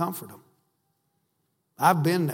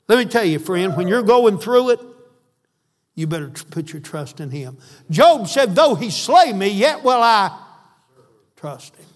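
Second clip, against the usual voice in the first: loud, rising preacher's cry, words driven hard; preaching at full force; 3 semitones higher, 8 dB louder than usual. Acted. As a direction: preach it out to the congregation, louder.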